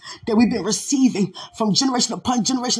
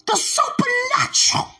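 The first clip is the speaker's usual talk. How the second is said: preaching at full force; 10 semitones higher than usual. Acted. Preacher to the congregation, defying Satan, in full-force preaching. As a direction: shout it out over the music, taunting the devil.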